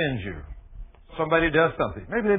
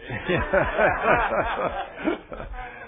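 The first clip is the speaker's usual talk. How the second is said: light chuckle after a joke; amused laugh; 3 semitones higher, 4 dB quieter than usual. Real, not acted. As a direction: laugh at the punchline.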